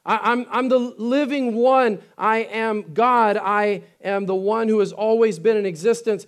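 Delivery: preacher voicing Jesus to the congregation; voicing Jesus proclaiming. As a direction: declare it with full conviction. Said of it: "He says".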